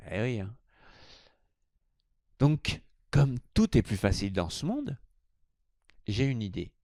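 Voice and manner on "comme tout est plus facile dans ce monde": ordinary talk, nothing marked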